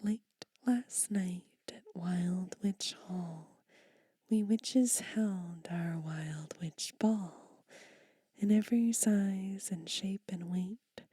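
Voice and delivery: rhythmic whispered poem, slow and emphatic; poem reading. Acted. Whispered children's poem read aloud to ASMR viewers. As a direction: read the rhyme with a bouncing beat.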